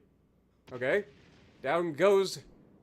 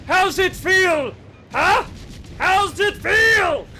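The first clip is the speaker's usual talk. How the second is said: slow, high, drawn-out shout; triumphant stretched-out jeer; gloating taunt; 4 semitones higher, slower than usual. Acted. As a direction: yell it slowly at a beaten enemy. Taunts the enemy while firing.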